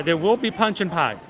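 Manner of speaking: usual speaking voice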